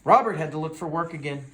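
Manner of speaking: ordinary delivery, nothing marked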